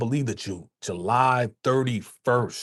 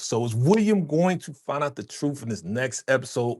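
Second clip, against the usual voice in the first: normal talking voice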